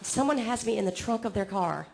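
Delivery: normal talking voice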